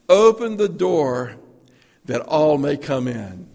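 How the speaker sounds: loud, slow public address call; loudspeaker announcement. Pretend. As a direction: boom it out like a park tannoy. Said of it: "you hear the loudspeaker say".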